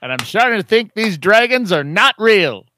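loud booming newsreader; news announcer voice. Acted. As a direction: announce it like a hyped anchor. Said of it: "Bert Skwecky".

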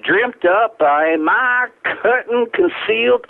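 slow, drawn-out Southern oratory; Southern accent. Acted. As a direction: recite it like a Southern orator savoring bourbon. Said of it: "the Southern accent, my own Southern accent".

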